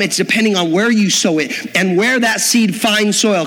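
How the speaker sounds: ordinary delivery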